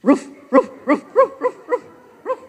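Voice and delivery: short, high ruff-ruff barks; high-pitched pretend dog woofs; Dalmatian barking. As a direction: bark like a Dalmatian. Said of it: "what's the sound a Dalmatian is going to make".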